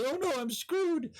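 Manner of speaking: high panicked squeal; panicked squeak. Retold or imitated. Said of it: "and you're like".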